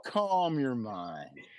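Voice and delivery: slow, soft, soothing mantra; calm mantra. Pretend. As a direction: hush it down like a meditation.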